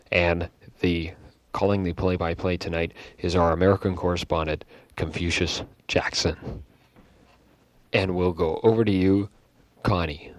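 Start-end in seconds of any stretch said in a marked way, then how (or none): none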